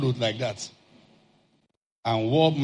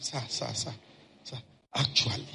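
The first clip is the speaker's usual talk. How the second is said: hushed seller; 4 semitones lower, 9 dB quieter than usual. Retold or imitated. Seller taps him quietly.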